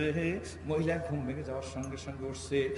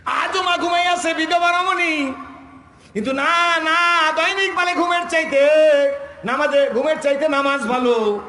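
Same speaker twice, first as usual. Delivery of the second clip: very high, loud sustained shout; full-force preaching; 13 semitones higher, 13 dB louder than usual. Real, not acted. Preacher telling of the sleeping widow, high preaching shout. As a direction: belt the story out at the top of the voice.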